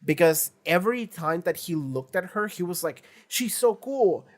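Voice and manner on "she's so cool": high, gushing imitation of admiration; mock smitten. Denji looks at Makima, mock smitten. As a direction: gush it in a put-on higher voice.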